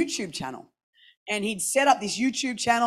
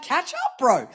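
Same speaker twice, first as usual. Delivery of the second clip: high mocking catch-up-bro; jokey auntie scolding a teen; teasing jab; 6 semitones higher, slower than usual. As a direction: tease him, pitch jumping way up. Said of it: "I'm like".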